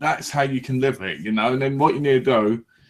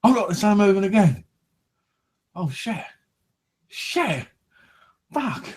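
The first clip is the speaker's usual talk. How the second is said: mock fright, building; 7 semitones higher than usual. Acting out spotting Nessie.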